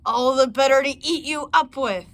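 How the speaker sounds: the wolf drops the act and lunges; wolf's roar. Acted. Wolf reveals himself to the girl with a loud snarl.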